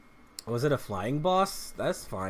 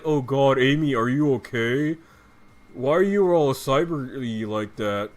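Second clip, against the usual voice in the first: a hint of deeper Sonic voice; lightly mock the new grave Sonic; 3 semitones lower than usual. Gamer mocking Sonic's deeper island voice, lightly imitated. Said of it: "his voice goes incredibly deep".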